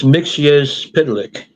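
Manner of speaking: "Mixius Pidlick", slow and syllable by syllable; careful pronunciation. Shown as a demonstration. Passing on a friend's version, carefully pronouncing "Mixius Pidlick". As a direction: sound out "Mixius Pidlick" slowly, as mix-ee-us pid-lick. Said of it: "our friend Ben says it's".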